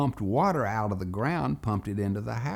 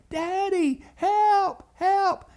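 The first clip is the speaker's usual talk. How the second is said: very high, loud cries for rescue; excited yelling; 6 dB louder, much slower than usual. Retold or imitated. Boys hear daddy's voice and cry out, yelling. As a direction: yell for him with all your hope.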